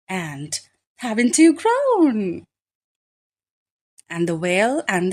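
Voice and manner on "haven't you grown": higher and louder, doting; fond greeting. A narrator's reading. The flock greets the returning snail, higher and fond. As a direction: dote on them out loud. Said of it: "who said".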